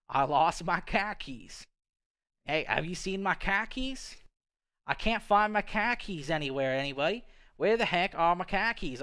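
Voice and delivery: hammed-up Boston accent hunting for keys. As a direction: play a put-out Bostonian, r's dropped. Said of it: "like, a Boston accent".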